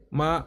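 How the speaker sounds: "ma" held high and steady; "ma" pitched high without rise or fall; first tone. Shown as a demonstration. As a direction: say "ma" on a high, level Mandarin first tone. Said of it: "is the steady tone".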